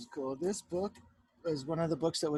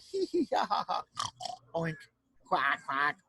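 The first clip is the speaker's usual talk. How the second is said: mock animal laughter; slower than usual. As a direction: squeal like giggling farm animals. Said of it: "the animals are laughing. They're like".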